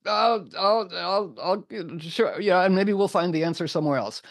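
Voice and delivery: high, stammering, panicky stalling; flustered mock reluctance. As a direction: stammer out a high, half-hearted agreement. Gawain reacts to marrying the hag.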